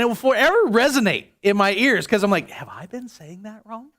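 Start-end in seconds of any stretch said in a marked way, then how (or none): 2.5-3.9 hushed self-doubt. Retold or imitated. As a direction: privately ask yourself, barely aloud. Voicing his own private worry.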